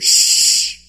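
forceful unvoiced shushing; loud shush. Crowd shushing the beggar, loud shush. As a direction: silence him with a hiss.

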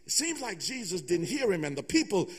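normal talking voice

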